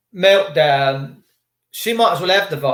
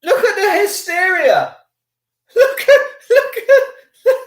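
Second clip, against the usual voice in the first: laughing mockery; 13 semitones higher, 4 dB louder, slower than usual. Real, not acted. Mocking woman's panic in video.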